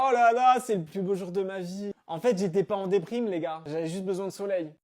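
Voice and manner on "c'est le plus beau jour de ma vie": normal talking voice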